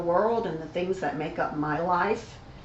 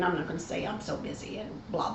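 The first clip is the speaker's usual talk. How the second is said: ordinary talk, nothing marked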